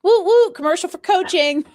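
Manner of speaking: loud, high whoop; excited cheer. Real, not acted. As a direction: cheer it like a hype man. Cheering the coaching plug.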